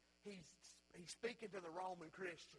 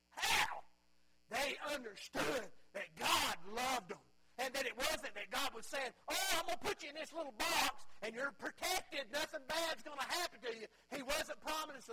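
preaching at full force; 6 semitones higher, 7 dB louder than usual. Acted. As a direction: cry out the question and answer it. Climax of the sermon.

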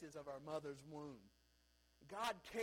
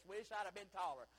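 usual speaking voice

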